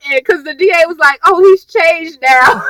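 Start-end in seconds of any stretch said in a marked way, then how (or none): 1.3-2.6 slow, loud mock wail; whiny imitation, raised and stretched; mock pleading. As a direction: plead it with drawn-out, hammed-up sorrow. Mocking the D.A.'s plea.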